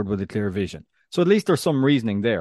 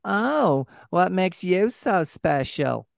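high, slow, drawn-out mocking voice; Olivia Soprano; 8 semitones higher, much slower than usual. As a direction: needle them in a high, put-on character voice. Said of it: "there is still the Olivia Soprano feel".

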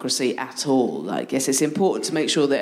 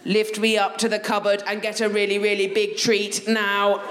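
robot voice; 4 semitones higher than usual. Retold or imitated. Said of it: "in a robot voice".

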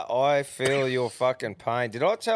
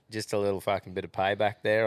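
ordinary delivery

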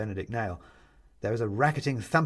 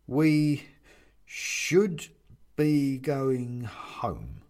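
slow, hesitant, with gaps between words; careful; much slower than usual. A narrator's reading. Bobbs backs Mum about going home, slowly and carefully. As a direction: pick each word very carefully. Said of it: "says Bobbs carefully".

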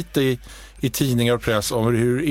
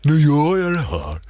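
imitating her impression of him. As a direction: parrot how she does him. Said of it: "när du gör mig".

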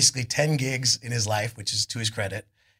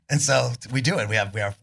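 ordinary talk, nothing marked

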